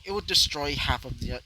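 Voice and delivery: ordinary delivery, nothing marked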